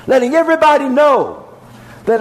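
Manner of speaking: ordinary delivery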